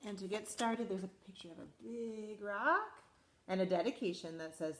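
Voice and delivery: lively storybook read-aloud; animated picture-book reading; reading to children. Acted. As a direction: read it to a class of little kids. Teacher reads picture book aloud.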